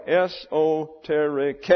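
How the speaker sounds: "esoteric" syllable by syllable, es-oh-teh-ree-KAY; stress on last syllable. Shown as a demonstration. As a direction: land firmly on a final "KAY" in "esoteric". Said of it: "with an accent on the last syllable".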